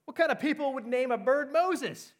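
high, loud incredulous question; acted burglar's mocking amazement; laughing disbelief. A narrator's reading. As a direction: scoff at the bird's name, voice way up. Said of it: "the burglar laughed".

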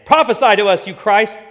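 high, loud jeering taunt; mocking taunt. Guards taunting the struck Jesus, high loud mockery. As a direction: sneer it at him.

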